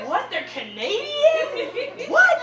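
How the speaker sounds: high, loud, drawn-out disbelief; mock shock. Viewer finds out the cast is Canadian, shocked. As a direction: squawk it in hammed-up amazement.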